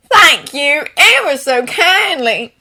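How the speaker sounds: put-on slow, sugary Southern Belle accent; thick Southern Belle accent, batting lashes. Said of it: "laying on a thick Southern Belle accent".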